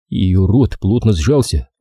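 ordinary delivery

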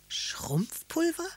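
high, drawn-out astonished question; wide-eyed, high-pitched questioning; excited child. A narrator's reading. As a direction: stretch the word upward in excited wonder. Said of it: "Karlchen war ganz aufgeregt".

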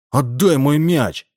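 put-on bossy little kid ordering it back; boy commanding. A narrator's reading. Boy ordering Borovkov, high slow bossy child's demand. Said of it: "скомандовал ребёнок".

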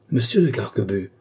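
a scandalized cry of his name, voice changed, sharp fall at the end; shocked exclamation. A narrator's reading. Clémence cries out at her cousin, scandalized by his boast. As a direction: gasp his name, outraged. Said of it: "s'écria Clémence, qui paraissait suffoquée".

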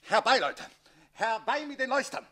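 high loud summons; loud commanding call to the gentlemen. Acted. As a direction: call the whole house in. Count summons the household loudly.

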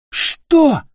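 high, loud yell of disbelief; outraged shout. A narrator's reading. Питер reacts to the captain's demand, shouting. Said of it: "закричал Питер".

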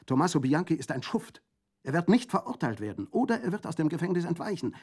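vehement outcry. A narrator's reading. Colomba denounces Tommaso to the prefect, vehemently. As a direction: fling it out in fury. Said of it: "rief in heftigem Tone".

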